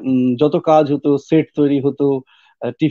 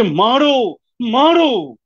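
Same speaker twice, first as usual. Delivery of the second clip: fierce cry; 9 semitones higher, much slower than usual. Acted. Quoted cry inside the poem.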